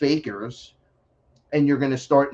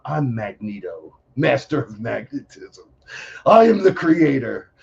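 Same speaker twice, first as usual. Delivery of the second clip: a hint of a comic-book villain proclaiming; Magneto voice. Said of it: "This is what I tell them now".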